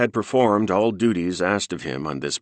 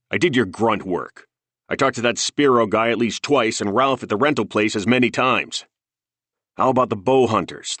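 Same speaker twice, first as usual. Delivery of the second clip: heated, louder, rising to a yell; raised angry voice; 6 semitones higher, 4 dB louder than usual. A narrator's reading. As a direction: vent it, furious and loud. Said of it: "Heller's raised voice reverberated".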